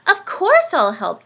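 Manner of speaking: high, loud eager reply; beaming. Acted. Dorothy agreeing to help Santa, beaming. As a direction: beam with delight. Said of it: "Dorothy beamed".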